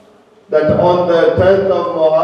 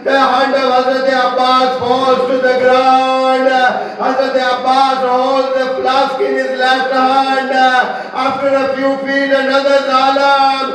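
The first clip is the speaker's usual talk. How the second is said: high wailing chant, climbing ever higher; impassioned lamenting preaching; 8 semitones higher than usual. Acted. Preacher tells congregation of Abbas losing his arms, wailing lament. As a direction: cry out each phrase on a long sustained high note.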